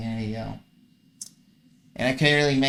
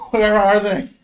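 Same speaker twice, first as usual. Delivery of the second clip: high, loud laugh through the words; laughing disbelief; 8 semitones higher, 8 dB louder than usual. Real, not acted. Gamer laughing at his missing heals, loud and high.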